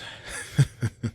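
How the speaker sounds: breathy mocking villain chuckle; scornful chuckle. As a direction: laugh at the fool. Villain mocks captive.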